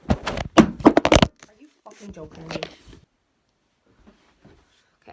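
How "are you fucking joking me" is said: loud, slow furious outburst; real angry shout. Real, not acted. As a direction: yell at the fallen camera. Camera falls over.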